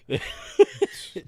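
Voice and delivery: loud, high laugh. Real, not acted. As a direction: crack up laughing. Laughing at the story.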